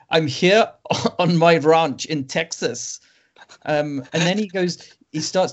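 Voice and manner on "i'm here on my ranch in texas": put-on thick German accent, slow, laughing through it; mock the director's heavy German accent; Uwe Boll. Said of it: "in a really thick German accent".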